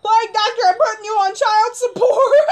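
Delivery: high shout breaking into laughter; indignant yelling. Real, not acted. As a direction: yell the threat, half laughing. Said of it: "screaming the way I am".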